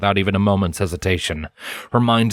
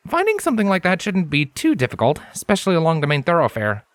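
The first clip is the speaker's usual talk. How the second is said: higher, earnest scholarly stallion, serious; Lens's voice; 9 semitones higher than usual. A narrator's reading. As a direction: speak as the studious unicorn, intent on his work. Narrator voices Lens.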